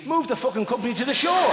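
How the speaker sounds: mock advice. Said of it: "your first proposal would be".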